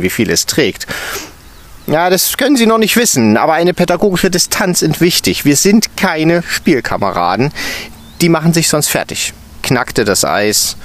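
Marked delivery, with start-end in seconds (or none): 1.9-9.3 teacher lecturing on pedagogical distance; stern teacher. A narrator's reading. Stern teacher lecturing the intern on distance.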